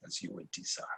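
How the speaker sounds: breathy dying whisper; last breath of a dying father. Acted. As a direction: barely get the words out. Dying emperor, weak final words.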